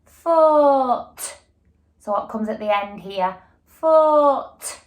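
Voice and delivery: "Foot" drawn out and loud; stretched word. Shown as a demonstration. Teacher checking the final sound with pupils, loud and stretched. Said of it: "Let's check".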